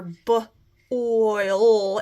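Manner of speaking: BOIL split into a short b, pause, drawn-out oil; sounded out. Shown as a demonstration. Teacher breaking boil into sounds for spellers, sounded out. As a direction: say "b", then stretch "oil". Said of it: "where I hear".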